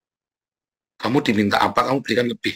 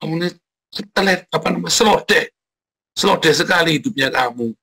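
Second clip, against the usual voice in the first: exasperated scolding; 5 semitones higher than usual. Retold or imitated. Preacher acting out scolding a sluggish servant, high and exasperated. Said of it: "Kan gitu kan".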